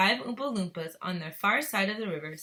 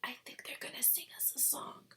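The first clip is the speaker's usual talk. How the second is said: soft unvoiced whisper; 4 semitones higher, 14 dB quieter than usual. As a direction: lean in and breathe it. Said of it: "whispered Grandpa Joe".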